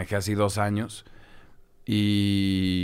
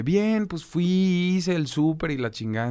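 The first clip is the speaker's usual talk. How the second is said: mom's voice, pitched clearly higher; imitating mom; 8 semitones higher than usual. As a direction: lightly play his mother chatting about her day. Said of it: "me empieza a decir como de".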